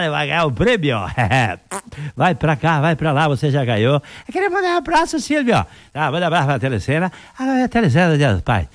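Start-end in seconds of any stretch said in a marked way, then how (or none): 4.3-5.6 high-pitched TV contestant greeting; caller on TV. Acted. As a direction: squeak out a shout-out to the host like an eager fan. Caller on TV show. (contestante agudo mandando abraço ao Silvio)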